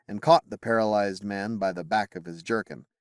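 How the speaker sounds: ordinary delivery, nothing marked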